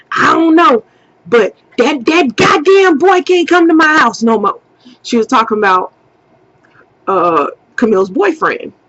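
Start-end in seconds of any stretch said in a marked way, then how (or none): 0.1-4.6 angry mom. Retold or imitated. Mom banning the boyfriend.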